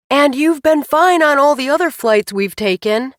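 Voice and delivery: loud reassuring call over noise; yelling. A narrator's reading. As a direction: shout it across the cockpit. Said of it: "Shiloh yelled".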